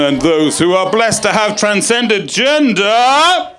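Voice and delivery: high, loud compere announcing the act; booming announcer impression. Comedian introducing herself to the crowd as a loud announcer. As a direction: boom it out like a club MC introducing the headliner. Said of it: "I just did a really good impression of somebody".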